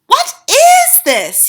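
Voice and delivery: put-on high, slow uneasy boy voice; boy asking. A narrator's reading. Bruno asking the Grand High Witch. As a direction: ask warily like a boy. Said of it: "he said".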